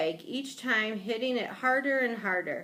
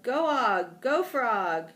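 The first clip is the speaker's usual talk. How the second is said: lightly hollered cheer, raised pitch; cheering yell; 3 semitones higher, 4 dB louder, slower than usual. A narrator's reading. Hamster cheers on the frog, mildly yelling. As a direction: urge your mount on. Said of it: "I yelled".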